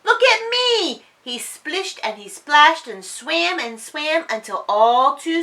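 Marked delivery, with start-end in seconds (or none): 0.0-1.0 high, loud call; gleeful look; excited cry. A narrator's reading. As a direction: shout for attention. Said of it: "cried Dylan".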